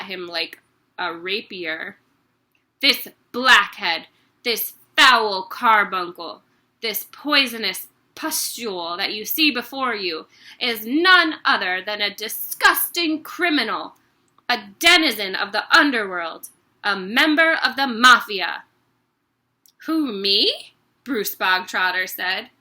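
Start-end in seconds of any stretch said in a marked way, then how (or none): 2.8-18.6 loud ranting insults; louder raging headmistress; furious tirade. Acted. Headmistress denouncing boy. 19.9-20.7 puzzled. Acted. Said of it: "looking genuinely puzzled".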